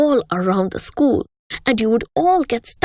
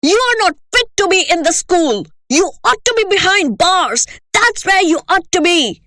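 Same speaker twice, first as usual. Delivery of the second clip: louder, higher raging tirade; shouting; 6 semitones higher, 4 dB louder than usual. Acted. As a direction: hurl each insult. Headmistress ranting at pupil.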